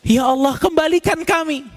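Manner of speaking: rising, desperate plea to God; pleading dead. Acted. The dead begging God to return them, desperate plea. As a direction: cry out to be sent back. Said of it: "Mereka bilang".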